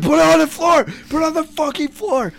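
high yelled order; mock yell. Friends yelling at Nathan to put pizza on the floor, loud. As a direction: shout it at the frozen friend. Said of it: "We're like".

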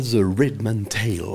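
English title in a practised English accent; careful English pronunciation from a French speaker. Shown as a demonstration. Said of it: "j'ai travaillé mon accent".

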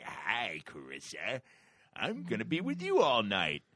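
hallucinated creature voice. Retold or imitated. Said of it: "crazy fucking, and they're like".

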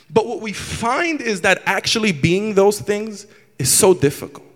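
ordinary talk

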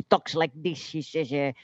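Filipino accent impression. Musician showing how his vocoder guy talks, imitated Filipino accent. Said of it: "he has a, a, like, a, a Filipino accent".

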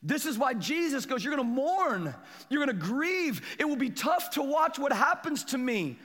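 high, driving pulpit proclamation; full-force preaching. Real, not acted. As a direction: build insistently on each repeated phrase.

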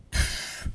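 soft dismissive scoff; soft pff. Acted. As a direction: blow out a quiet pff.

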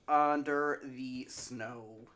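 drawn-out deadpan; very slow. Pretend. Cohost answering where to find them, very slowly. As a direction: stretch every word slowly. Absurd dodged plug answer.

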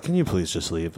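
low, soft, weary plea; subdued, nerdy bar manager. Retold or imitated. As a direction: plead quietly like a worn-down manager. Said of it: "kinda, like, nerdy".

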